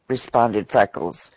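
plain reading voice

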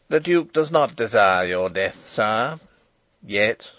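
lightly sneering henchman; coolly mocking, understated; mild sneer. A narrator's reading. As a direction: put a touch of scorn on it. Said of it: "sneered Detchard".

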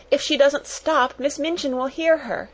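high, urgent schoolgirl warning; alarmed cry. A narrator's reading. Jessie warning that the headmistress will hear, crying out. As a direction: warn them, voice pitched well up. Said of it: "cried Jessie".

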